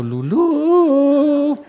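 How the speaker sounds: high, loud, long howl of "Lulú"; hammed-up yell, leaping up in pitch; mock madman's scream. Retold patient strapped to a board, screaming. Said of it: "y todo y gritando".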